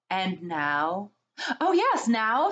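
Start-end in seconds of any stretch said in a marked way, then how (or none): 1.6-2.5 high, eager and bubbly; excited. A narrator's reading. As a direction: bounce with glee. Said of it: "said Lucy, jumping up and clapping".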